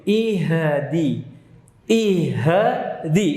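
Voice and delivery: "Ihdi" said as a slow "i-he-di"; exaggerated wrong "Ihdi" with echoing "he"; bounced H. Shown as a demonstration. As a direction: add a bouncing vowel after the H. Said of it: "Itu pantulan pada hak sukun".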